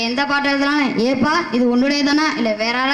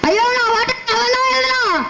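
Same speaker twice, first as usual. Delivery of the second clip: high-pitched hammed-up wail; comic wailing; 9 semitones higher than usual. Poor poet alone, lamenting his fate in a high wail. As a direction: wail it like an overdone mourner.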